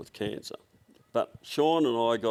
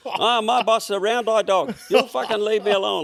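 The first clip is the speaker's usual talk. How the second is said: much higher and louder put-on Chinese accent, hammed up; mock Chinese accent; 10 semitones higher, 6 dB louder than usual. Retelling the prank phone call, mock Chinese accent, loud and high. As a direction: shout it like the cheeky phone clerk. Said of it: "like this".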